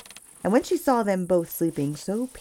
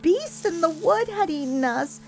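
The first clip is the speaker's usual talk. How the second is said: put-on much higher, despairing little girl; girl lamenting; 12 semitones higher than usual. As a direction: wish they had died together, in a high girl's voice. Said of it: "she cried".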